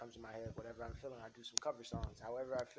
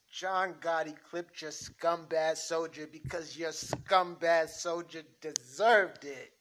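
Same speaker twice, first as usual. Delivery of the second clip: higher and louder, heated mobster shouting down his boss; loud mob argument; 8 semitones higher, 17 dB louder, slower than usual. Acted. Gotti's defender to the boss, loud angry shouting. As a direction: yell it like a street guy who won't be told off. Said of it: "I can afford to be that loud".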